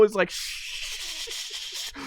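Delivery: whoosh sound. Acted. Cookie whooshing sound effect.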